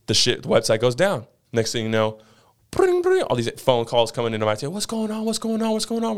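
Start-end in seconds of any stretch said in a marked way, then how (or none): 2.7-3.2 high sung bring bring; squeaky phone ring; phone ring imitation. 4.7-6.2 high, frantic what's going on; mock frantic callers, pitched up; panicked callers.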